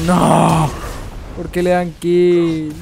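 loud, slow outraged yell; raging gamer shout. Real, not acted. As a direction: bellow at the game in disbelief. Shouting at teammates' deaths.

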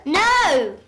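loud, flat refusal; a child shouting no at breakfast; shouted no. Acted. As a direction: blurt out a loud no.

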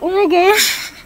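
high, loud panicky oh my gosh; freaked-out squeal. Retold or imitated. To her viewers, retelling the chicken scare, a panicky squeal. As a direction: squeal it like a chicken just ran at you. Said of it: "I freaked out".

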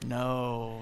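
low, soft, drawn-out dismay; hushed low voice with a long pause; grave professor. Acted. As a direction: sigh it slowly like a disappointed professor. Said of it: "the professor's like".

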